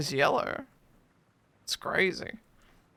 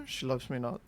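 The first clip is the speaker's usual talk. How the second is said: gloomy low mutter; 6 semitones lower, 9 dB quieter, faster than usual. Pretend. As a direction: lower and softer, glum. Petal game alternating voices.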